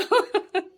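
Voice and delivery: high burst of giggles; amused laugh. Real, not acted. Recalling man's stone-throwing advice, amused laugh. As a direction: crack up at the memory.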